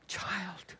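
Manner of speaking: put-on very soft, tender "Child". Shown as a demonstration. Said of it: "Isn't that the tone?".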